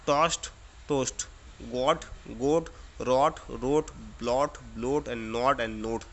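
टॉस्ट टोस्ट गॉट गोट रॉट रोट spoken slowly, aw then oh each time; slow minimal pair drill. Shown as a demonstration. Teacher drilling pairs for the class, slow and deliberate. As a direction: contrast open aw with long oh, pair by pair, slowly. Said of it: "औ का sound ... ओ का sound".

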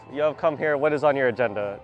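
ordinary talk

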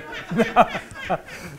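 amused laugh; real laugh. Real, not acted. Preacher amused at his spoiled drink offer, real laugh. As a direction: laugh at your own gag.

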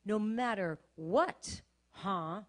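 a touch of slow taunting sneer, rising at the end; mocking tone. The enemy taunting her prayer back at her, mild mocking sneer. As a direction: lightly mimic a jeering enemy. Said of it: "Just in that very tone".

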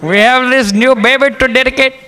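high-pitched pastor impression; put-on high voice, ushering everyone to sit; pastor addressing congregation. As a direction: call out high, imitating the pastor. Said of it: "he says to the congregation".

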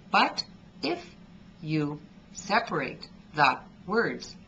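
pauses between 'but' and 'if', each word cut off; choppy separated words. Shown as a demonstration. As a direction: stop the voice after every word. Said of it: "as I just did there".

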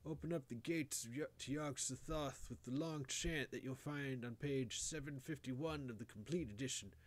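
choking out last orders to a grandson; dying old grandfather. A narrator's reading. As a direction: rave in country dialect from the deathbed. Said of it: "wheezing to choke out a few words to his grandson".

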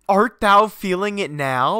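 ordinary talk, nothing marked

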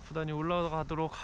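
muffled by mask; speaking behind mask; through a face mask. Real, not acted.

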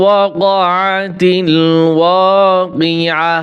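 slow, drawn-out tajweed chant; Quran recitation. Acted. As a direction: recite each word with long held vowels. (ধীরে টেনে টেনে কোরআন তিলাওয়াত)

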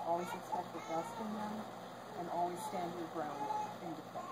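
measured formal ceremony voice; wedding officiant. Acted. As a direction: speak like an officiant at the altar. Officiant's sermon on love.

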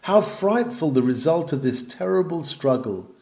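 usual speaking voice

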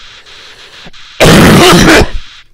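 harsh throat-clearing cough; coughing after straining the voice; strained cough. Real, not acted. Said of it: "That's gonna kill my throat".

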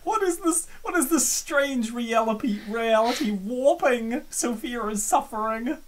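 ordinary talk, nothing marked

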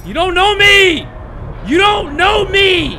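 high, loud yell; shouting at the game in defiance; defiant shout. Real, not acted. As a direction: scream it back at them. Yelling at the monsters.

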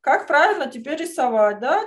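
normal talking voice

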